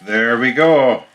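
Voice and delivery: ordinary talk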